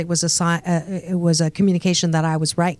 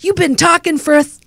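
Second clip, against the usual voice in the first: higher, coaxing voice to the inner critic; coaxing a tired inner critic to sit down; 8 semitones higher than usual. Acted. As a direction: gently talk your critic into resting. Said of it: "my critic's name is Rose".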